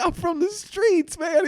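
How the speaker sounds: high, slow mock street-tough voice; hammed-up street swagger; lightly mocked street guy. Playing tough city guy.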